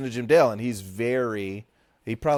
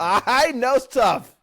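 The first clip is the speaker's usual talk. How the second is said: mocking; 12 semitones higher, 7 dB louder, much slower than usual. Mocking friend's boast.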